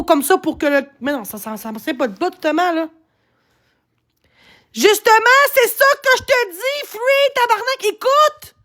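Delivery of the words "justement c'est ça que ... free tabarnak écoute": high, strained outburst; exasperated. Real, not acted. Streamer yelling at a commenter to listen. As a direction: snap at them, pitched way up.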